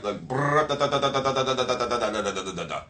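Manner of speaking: trilled brrr into machine-gun da-da syllables; rattled-off playback. Retold or imitated. Copycat repeating every word back, rattling mimicry. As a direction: rattle nonsense like a recording, sinking in pitch. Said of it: "just repeat it back".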